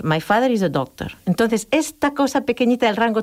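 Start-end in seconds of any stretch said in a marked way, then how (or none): 0.0-1.1 "My father is a doctor" low and level; "My father is a doctor" kept flat and narrow; narrow Spanish pitch range. Shown as a demonstration. Teacher shows the narrow Spanish pitch range, flat. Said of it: "Y nosotros diríamos".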